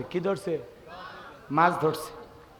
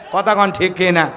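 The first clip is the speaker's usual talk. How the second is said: ordinary delivery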